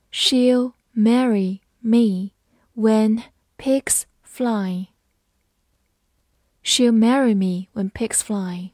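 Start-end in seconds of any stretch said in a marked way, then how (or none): 0.1-4.9 word by word, with clear gaps; slow reading. Shown as a demonstration. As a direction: read each word out slowly for learners. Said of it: "好，我们慢慢来读". (慢慢逐词朗读)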